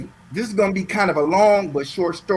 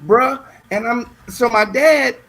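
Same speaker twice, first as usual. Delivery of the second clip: usual speaking voice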